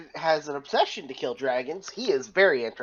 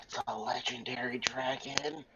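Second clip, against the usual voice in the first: whisper; 11 dB quieter than usual. Real, not acted. Whispered aside about dragon.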